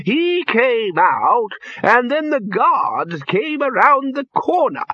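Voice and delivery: put-on high old man's voice, laughing through the story; an old man tickled by his memories; high, slow, gleeful with wheezing laughs; giggling old wizard. A narrator's reading. Poons laughing through a tale.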